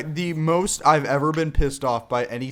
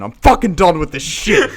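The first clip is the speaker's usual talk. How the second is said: louder retold outburst; exploding; 6 dB louder than usual. Imitating a kid giving up on the game, exploding. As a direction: imitate a friend finally blowing up. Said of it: "Chris was about to explode here".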